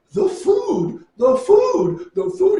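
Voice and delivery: high-pitched imitation of a wailing woman; mock sobbing. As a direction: wail it in a put-on, very high voice. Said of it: "weeping and sobbing".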